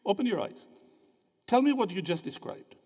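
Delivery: usual speaking voice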